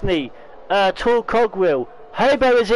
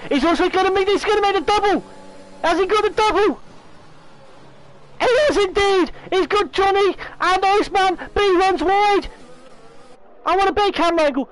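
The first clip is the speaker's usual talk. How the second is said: excited; 8 semitones higher than usual. Real, not acted. Commentator calls the double move.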